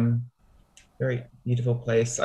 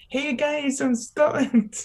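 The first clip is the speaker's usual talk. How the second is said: high-pitched mock Scottish accent; thick Scottish accent; 14 semitones higher, slower than usual. New arrival greeting people in hometown, high mock Scottish accent. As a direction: imitate your younger self with a squeaky-high Scottish accent. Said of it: "really thick Scottish accent".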